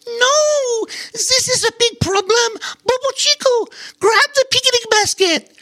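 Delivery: a small squirrel yelling a frantic warning; squirrel blurting out in alarm. Acted. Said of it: "blurted out".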